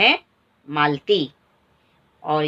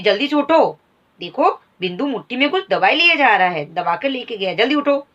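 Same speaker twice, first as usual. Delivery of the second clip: urgent urging; 4 semitones higher than usual. Acted. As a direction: rouse the husband in alarm. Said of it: "बोली झटपट".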